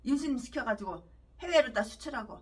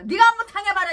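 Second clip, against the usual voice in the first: preacher shouts his anger at the pastor; furious shout; 7 semitones higher, 9 dB louder than usual. Real, not acted. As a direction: yell it, higher and louder.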